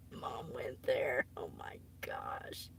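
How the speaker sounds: slow breathless whisper; mortified whisper. Real, not acted. Streamer to her audience, whispering in embarrassment. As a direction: hide in the words, barely voiced.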